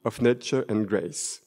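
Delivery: English title read in a thick French accent; self-mocking French-accented English. Shown as a demonstration. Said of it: "c'était la pause avec mon accent français magnifique".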